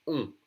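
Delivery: "on" isolated as a nasal French vowel. Shown as a demonstration. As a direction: sound "on" through the nose without an N. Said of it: "very nasal sound in French".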